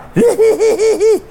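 very high, loud squeaky giggle. A narrator's reading. Snowchild giggles along, high squeaky giggle. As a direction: squeal out a quick hee-hee. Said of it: "giechelde het kind".

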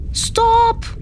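very high, sustained shout; full-chested yell. A narrator's reading. Tiny crab facing down the huge whale, yelling high. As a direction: belt it out to halt someone in their tracks. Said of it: "brulde uit volle borst".

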